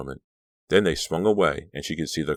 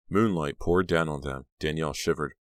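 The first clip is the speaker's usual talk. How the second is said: usual speaking voice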